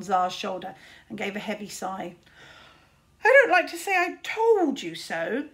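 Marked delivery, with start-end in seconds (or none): 3.2-5.5 high, loud, sighing complaint; a world-weary bird lecturing; weary raven voice. Acted. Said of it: "said Caliburn".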